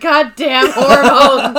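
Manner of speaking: loud, drawn-out mock lament; mock cursing. Pregnant viewer blaming hormones for tears, mock exasperation. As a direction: drag it out in exasperation. Tearful at film, blames hormones.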